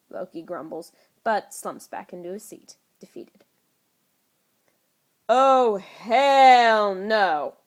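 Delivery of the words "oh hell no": loud blaring outburst. A narrator's reading. Fury sees the children and Tony, blaring. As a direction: blast it out in shock. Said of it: "come blaring from Nick Fury's mouth".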